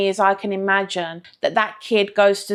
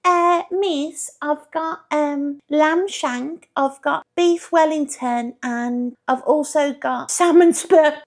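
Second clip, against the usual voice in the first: a hint of a sweet little girl, higher and slow; lightly voiced schoolgirl; 5 semitones higher, slower than usual. Daughter telling the teacher her lunch, lightly voiced girl. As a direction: play the pupil listing her fancy lunch, higher. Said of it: "when she turns up to school".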